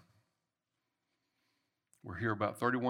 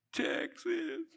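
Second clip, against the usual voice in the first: laughing reply. Retold or imitated. Wife quoted, laughing.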